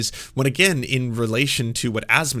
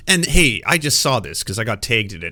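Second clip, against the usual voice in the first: loud, indignant mock scolding; imitated outraged call-out; 4 dB louder than usual. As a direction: act out hammed-up outrage at a tiny target. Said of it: "went out of your way to be like".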